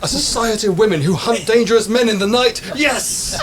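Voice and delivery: high, gleeful burst; excited. A narrator's reading. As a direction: cry it out with delight. Mary gleeful at her new idea.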